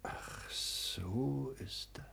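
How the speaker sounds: very slow, breathy whisper. Acted. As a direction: let the realization sink in softly. Father reacts, sly realization. (langsam geflüstert)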